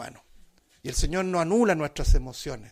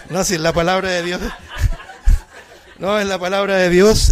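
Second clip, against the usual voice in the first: laughing; 3 semitones higher, 9 dB louder than usual. Real, not acted. Laughing defense of the reading.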